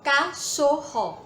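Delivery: slow, clear word. Shown as a demonstration. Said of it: "A palavra".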